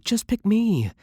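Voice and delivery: high, urgent plea; pleading high. Acted. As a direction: beg to be chosen. Desperate plea to partner.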